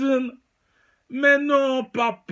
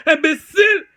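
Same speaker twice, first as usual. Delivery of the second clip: sudden loud high "Imbécile!"; shouted insult; 7 semitones higher, 6 dB louder than usual. Acted. Mock outrage, yelling at the rival. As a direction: bark the insult at him.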